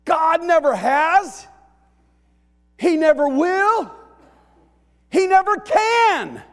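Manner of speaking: high, full-force preaching shout; ringing shouted declaration; emphatic proclamation. Retold or imitated. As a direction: proclaim it loudly with big pauses. Preacher shouts the psalmist's turn.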